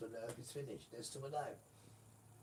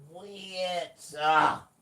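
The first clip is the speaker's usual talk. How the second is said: sudden high loud yell; Father's shout; 8 semitones higher, 15 dB louder than usual. Retold or imitated. Father yelling at the men mixing seed, loud. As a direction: bark the stop like an angry father.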